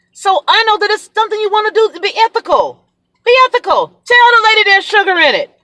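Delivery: high-pitched, exasperated appeal; indignant scolding. Real, not acted. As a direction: scold the absent seller, voice climbing high. Indignantly lectures the absent saleswoman.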